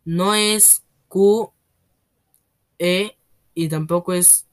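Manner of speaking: slow, careful letter-by-letter spelling; hesitant slow spelling of each letter; very slowly spelled. Acted. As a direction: spell it out slowly, afraid of getting it wrong. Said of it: "luego muy despacio dijo".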